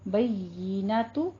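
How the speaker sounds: "बय्यिनतु" slow with a fine held ee; careful tajweed "बय्यिनतु", ee not e; thin ee sound. Shown as a demonstration. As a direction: stretch the doubled y into a clear ee in "बय्यिनतु". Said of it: "मुंह को पस्त करके बारीक आवाज".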